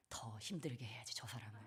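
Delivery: low, whispered sly thought; whispered child's revenge plan; child's spiteful thought. Retold or imitated. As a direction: mutter a kid's secret grudge. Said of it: "애들은 속으로 그렇게 생각한다니까요".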